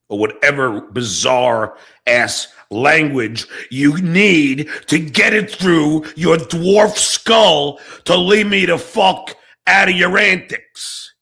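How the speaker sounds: slow, building to a loud rant; angry escalation. Real, not acted. Streamer telling Vince to leave him alone, angrily building.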